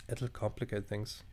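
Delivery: quiet, choked-up delivery; getting emotional. Real, not acted. Player reading the breakup line, getting emotional. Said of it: "where I'm getting emotional again".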